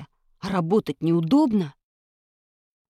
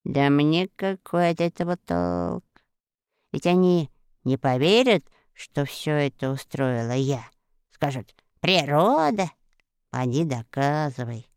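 doubtful witch; 3 semitones lower than usual. A narrator's reading. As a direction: weigh it with doubt. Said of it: "колдунья продолжала".

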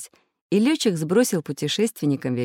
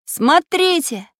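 high, drawn-out excited shout; sudden shout; 11 semitones higher, 6 dB louder, much slower than usual. A narrator's reading. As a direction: suddenly cry out to draw attention. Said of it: "вдруг закричал Самоделкин". (внезапный протяжный крик)

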